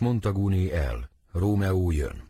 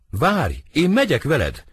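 urgent call, higher and louder; calling after; 6 semitones higher, 4 dB louder than usual. Acted. Benvolio to his departing friend, calling after him. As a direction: call out to stop him leaving.

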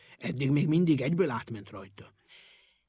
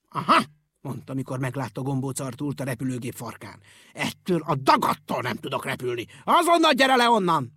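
angry pilot; 7 dB louder than usual. A narrator's reading. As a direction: order him down angrily. Pilot catches him on tail.

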